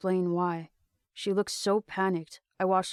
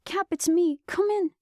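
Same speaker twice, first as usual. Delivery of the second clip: high, loud plea into a radio; urgent calling; 11 semitones higher, 5 dB louder than usual. Acted.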